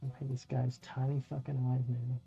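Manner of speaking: ordinary talk, nothing marked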